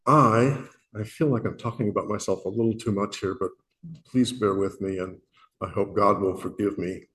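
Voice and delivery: normal talking voice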